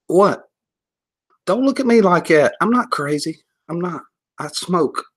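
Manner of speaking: high-pitched, defensive protest; mock indignant. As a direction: insist on your sanity, comically affronted. Protesting imagined stares.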